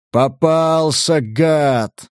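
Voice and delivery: drawn-out gleeful growl of triumph; joyful roar. A narrator's reading. Giant crowing over his wounded prey, joyful roar. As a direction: roar it slowly with relish. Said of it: "радостно проревел он".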